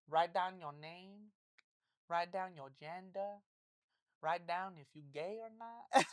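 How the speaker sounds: slow, deadpan imitation of a Portuguese accent; mock Portuguese accent. Imitating Brazilian manager during a test, mock Portuguese accent. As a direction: dictate each order slowly in a put-on Brazilian accent. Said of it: "Where is that accent from?".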